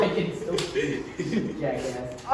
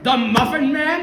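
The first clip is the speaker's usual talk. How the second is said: shocked question; 6 semitones higher, 6 dB louder than usual. Acted. Shocked reply in acted skit.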